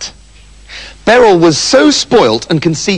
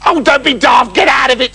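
higher, dismissive princess; scornful rebuff; 8 semitones higher than usual. Acted. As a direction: shoo the suitor away with scorn.

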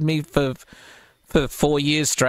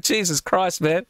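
ordinary talk, nothing marked

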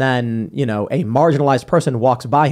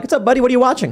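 much higher bright mock greeting; imitating Seamus; 11 semitones higher, faster than usual. Roommate greeting a friend, high imitating voice. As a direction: play the roommate breezing in, pitched way up.

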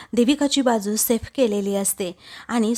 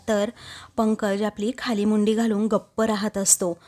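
ordinary delivery

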